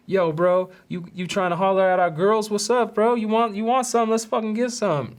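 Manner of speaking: mock a dude picking a fight; imitating a thug mouthing off; talking shit. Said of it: "They're yapping. They're like".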